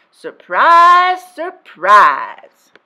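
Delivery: loud, mock-astonished, dropping on the second; sarcastic surprise surprise. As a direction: roll your eyes at the obvious. Sarcastic aside after predictable failure.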